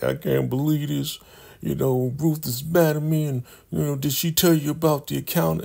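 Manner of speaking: high, whiny mock worry; worried drunk voice. [name] frets to River over moonshine, in a high whiny mock voice. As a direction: fret like a tipsy worrier.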